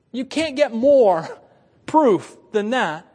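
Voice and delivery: slow, loud, every word weighed; emphatic climax. Acted. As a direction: drag it out to land the proof. Preacher's climactic point.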